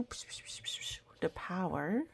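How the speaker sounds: whispered psh psh of a sprayer; soft hissing machine sounds; machine psh noises. Retold or imitated. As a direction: imitate the sprinkle machine puffing.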